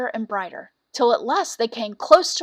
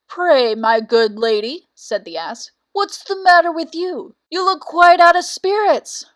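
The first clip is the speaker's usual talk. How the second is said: a lightly voiced donkey, concerned; lightly voiced donkey showing concern; kindly donkey; 5 semitones higher, 7 dB louder than usual. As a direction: ask the cat what's wrong, gently. Ass speaks to sad cat.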